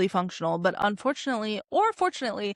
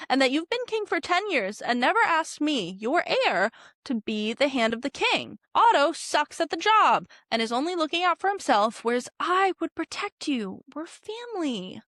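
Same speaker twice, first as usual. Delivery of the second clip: whiny Daemon; 7 semitones higher, 3 dB louder than usual. Retold or imitated. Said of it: "Daemon is like".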